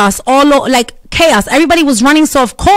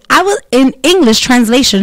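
ordinary talk

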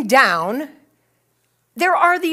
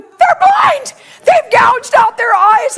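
high, shouted horror; acted hysteria; 10 semitones higher, 6 dB louder, slower than usual. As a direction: shout the alarm in overblown panic. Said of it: "I'm freaking out".

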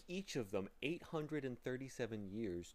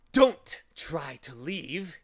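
loud, high, drawn-out command; menacing order; 7 semitones higher, 14 dB louder, much slower than usual. A narrator's reading. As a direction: order them sharply to stay. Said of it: "he ordered".

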